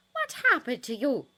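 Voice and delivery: high, loud shocked demand; worried fury at the damage; alarmed anger. Acted. Nurse holding Harry's head, loud alarmed question. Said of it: "she raged".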